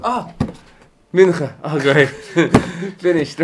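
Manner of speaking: loud exclamation of relief; mock relieved outburst. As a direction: act out the bored congregant's joy it's over. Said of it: "You don't wanna close the book".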